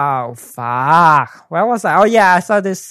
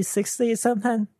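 ordinary delivery

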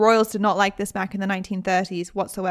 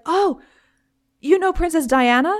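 coworker quote; 7 semitones higher than usual. Retold or imitated. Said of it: "And he was like".

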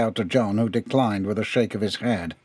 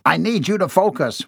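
higher, forceful angry boss; thundering; 7 semitones higher than usual. A narrator's reading. Boss demanding his employee focus, thundering. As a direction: cut in sharply to demand attention. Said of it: "Wolfenstein thundered".

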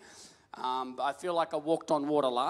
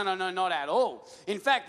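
normal talking voice

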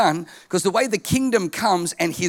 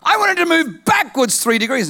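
"I want it" louder and higher; bold loud demand; 8 semitones higher, 5 dB louder than usual. Retold or imitated. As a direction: demand it louder the second time. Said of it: "he says".

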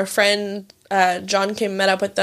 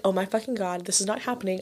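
mortified under breath; 8 dB quieter than usual. Retold or imitated. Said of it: "I literally was like".

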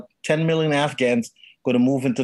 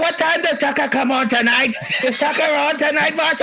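mock New Jersey; 11 semitones higher than usual. Husband calls to his wife Martha in a mock New Jersey accent.

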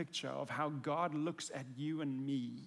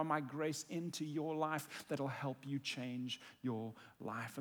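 Jesus pleading gently; 3 semitones higher, 4 dB louder than usual. Pretend. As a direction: plead with love, then let it fall to a hush. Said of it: "And Jesus is going".

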